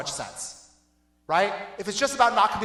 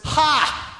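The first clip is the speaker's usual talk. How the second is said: a single scornful "Ha!", higher and louder; mocking laugh; 7 semitones higher, 6 dB louder than usual. Jesus scoffing at the self-righteous, mocking laugh. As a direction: scoff at them in one burst.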